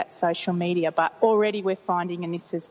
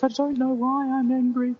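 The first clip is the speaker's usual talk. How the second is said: a hint of a higher, teenage daughter's voice; imitating daughter; 6 semitones higher, 3 dB louder than usual. Mother lightly voicing her teenage daughter's reply.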